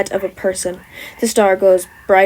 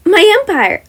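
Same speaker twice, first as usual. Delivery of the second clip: high, loud, delighted boast; proud exclamation; 11 semitones higher, 8 dB louder than usual. Acted. Wizard boasting to the class about her kingdom, high and loud. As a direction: announce it with glee.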